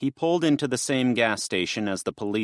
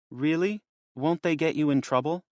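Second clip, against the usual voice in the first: put-on higher, girlish worried voice; worried young girl; 7 semitones higher than usual. Lydia asking Garth about the police, lightly higher and worried. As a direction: lift into a young girl's anxious question.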